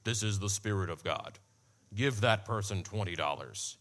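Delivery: very low, put-on divine voice; hammed-up solemn command in a deep voice; mock Spirit of God. Shown as a demonstration. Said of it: "It's not gonna sound like this".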